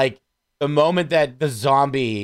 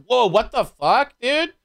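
drawn-out, rising dude-bro whoa; a slow stoner whoa, pitch soaring at the end; mock empty reaction; 9 semitones higher, slower than usual. Friends mocking a movie hero's flat reaction, in a slow stoner whoa. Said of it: "his reaction's like".

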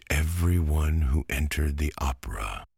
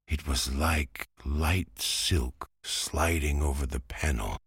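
usual speaking voice

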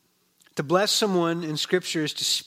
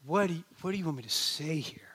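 whispered, stunned plea; whispered prayer in shock; stunned whisper to God; 3 dB quieter than usual. Retold or imitated. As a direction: breathe it out in disbelief. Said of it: "I'm like".

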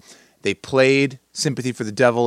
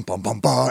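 humming inspirational music. Pretend. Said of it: "I have the bum".

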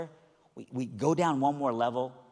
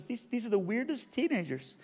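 higher-pitched imitated woman's voice; bewildered cleaning lady; 10 semitones higher than usual. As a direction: lift the voice, puzzled and earnest. Bewildered lady explains herself.

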